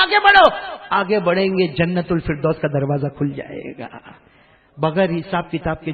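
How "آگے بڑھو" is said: commanding call. Acted. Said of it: "اللہ کہے گا".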